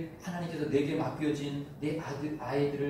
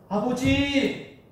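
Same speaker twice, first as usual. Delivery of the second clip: long, high, loud cry of "아버지!"; tearful cry to God; 10 semitones higher, 8 dB louder, much slower than usual. Retold or imitated. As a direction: call out to the Father in tears. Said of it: "하면서 눈물 흘리면서 기도했던".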